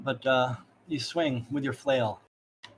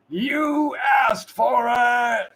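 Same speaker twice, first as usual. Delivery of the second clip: very slow, high, loud threat; slow menacing shout; 10 semitones higher, 7 dB louder, much slower than usual. Acted. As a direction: bellow it as he lets the dog go. Guard releases the dog.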